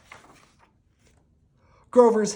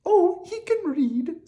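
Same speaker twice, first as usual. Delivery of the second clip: very high, lamenting princess voice; a princess pouring out her sad tale; 4 dB louder than usual. Acted.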